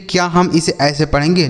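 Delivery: usual speaking voice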